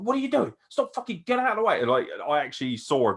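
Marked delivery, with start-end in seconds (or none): 0.0-1.8 high, exasperated mock scolding; mock annoyed Pete Dunne. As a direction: shoo her away in a squeaky huff. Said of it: "he was like".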